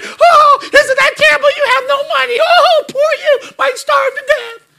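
very high, sneering falsetto pity; mocking. Shown as a demonstration. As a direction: fake sympathy, dripping with scorn. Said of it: "that's exactly what it means".

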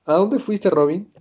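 lightly voiced Luffy; curious Luffy. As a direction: ask her bluntly like a curious kid. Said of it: "Luffy le dice".

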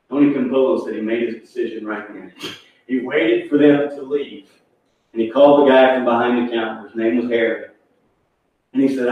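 ordinary talk

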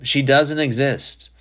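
high-pitched, insistent statement; exasperated insistence. A narrator's reading. Father telling son his mother is gone, exasperated.

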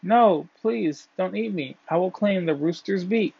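high-pitched plea, only mildly loud; pleading loud cry. A narrator's reading. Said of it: "the grass cried out very loudly".

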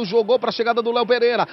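ordinary delivery

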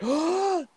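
high, sharp retold gasp; sudden high intake of breath; shocked gasp. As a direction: gasp as if just robbed. Retells shock at cancelled money.

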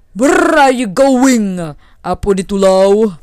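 loud, drawn-out rumbling demand; booming, slow challenge; challenging thunder. Acted. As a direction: call out slowly as the mighty thunder.

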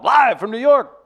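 loud high announcer shout; announcer call. Acted. Host calling out the segment to listeners, announcer shout. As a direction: boom it out like a show intro.

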